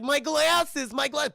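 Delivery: high, loud mock-distressed kid voice; panicked kid. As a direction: wail like a kid who lost something. Kid searching for lost glasses.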